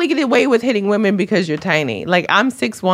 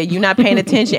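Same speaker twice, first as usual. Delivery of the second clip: ordinary talk, nothing marked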